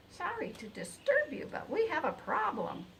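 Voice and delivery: high, fussing hen voice tucking him in; clucking hen. A narrator's reading. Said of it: "Ruby woke me with some news".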